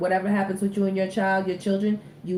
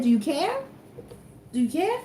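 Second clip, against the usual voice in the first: incredulous; 9 semitones higher than usual. Pretend. Teasing Nevaeh about pregnancy.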